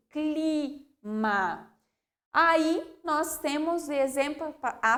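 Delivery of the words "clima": "Clima" with C then L joined; C first, then add the L; careful cluster. Shown as a demonstration. Teacher modelling the joined sounds. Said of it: "do C e depois eu coloco o L junto".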